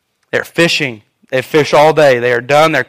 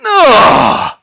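put-on teen groan; 5 semitones higher, 3 dB louder than usual. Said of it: "mowing the yard request".

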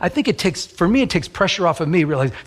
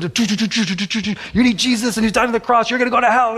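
put-on zealous young preacher; 5 semitones higher than usual.

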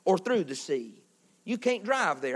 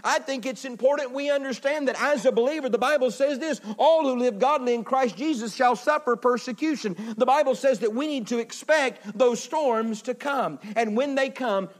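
higher-pitched, driving sermon delivery; impassioned preaching; 6 semitones higher, 3 dB louder than usual. Real, not acted. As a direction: preach it with full, rolling energy. Preaches at full force.

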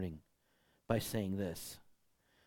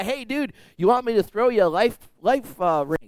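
high, louder, breezy offer; casual boat guy; 9 semitones higher, 8 dB louder than usual. Retold or imitated. Boat guy offering the drowning man a ring, breezy. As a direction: toss it off like a laid-back boater. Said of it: "the boat says to the guy".

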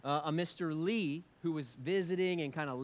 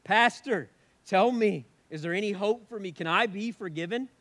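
loud, high pleading cry across the room; 7 semitones higher, 14 dB louder than usual. Retold or imitated. Cook crying to pastor across room, loud high pleading. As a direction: plead loudly for forgiveness. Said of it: "cried to me across the room".

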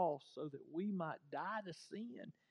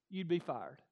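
ordinary talk

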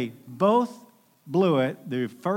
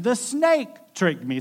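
mock excuse; 8 semitones higher, 5 dB louder than usual. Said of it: "She answered".

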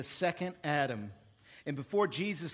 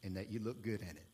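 softer, smooth, fast sales patter dropping to a hush; car salesman; 7 semitones lower, 5 dB quieter than usual. Retold or imitated. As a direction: ease the customer into the deal. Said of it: "as a car salesman, I used to say".

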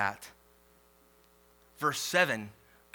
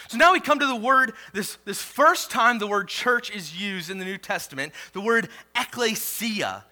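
louder, higher, quick launch into ekklesia; preaching burst; 7 semitones higher, 6 dB louder than usual. Acted. Pastor introducing the word ekklesia, loud preaching. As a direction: surge forward with energy.

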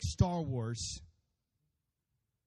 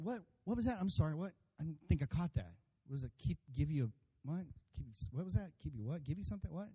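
softer, fumbling confusion with questioning lifts; hard of hearing; 7 dB quieter than usual. Acted. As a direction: play a baffled listener piecing words together.

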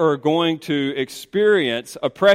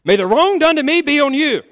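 raised, high outburst of accusation; angry Sarai; 11 semitones higher, 6 dB louder than usual. Acted. Sarai blaming her husband Abram, accusing. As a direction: hurl the blame in a high, loud voice. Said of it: "Sarai said to Abram".

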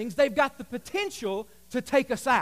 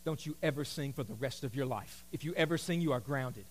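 stern parent; 11 semitones lower, 11 dB quieter than usual. Retold or imitated. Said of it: "they should have said".

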